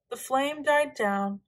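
usual speaking voice

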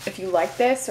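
usual speaking voice

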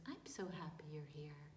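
fast, hushed whisper to a newborn; tender whisper. Acted. Mary whispering tenderly to her newborn baby. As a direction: breathe it softly to the baby. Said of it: "and said".

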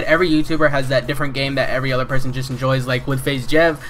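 ordinary delivery

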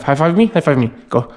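ordinary talk

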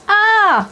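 a high, loud startled scream; high loud yell of alarm; sudden scream. A narrator's reading. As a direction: shriek out in alarm. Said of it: "wrzasnęła Gabrysia".

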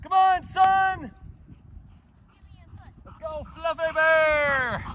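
high, drawn-out rallying call. Real, not acted. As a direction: call them down the hill, stretching the words. Calling son and dog down hill.

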